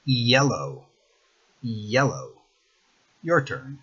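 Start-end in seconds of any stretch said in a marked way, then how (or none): 0.0-2.3 Yellow begun with a Spanish I glide, "I-elou"; Spanish I start. Shown as a demonstration. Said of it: "replace it in your mind with the letter I".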